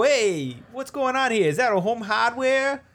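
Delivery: very high, put-on excited voice; mock amazed Canadian. Canadian spotting a Home Hardware in the background, mock amazement. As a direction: exclaim it with hammed-up amazement.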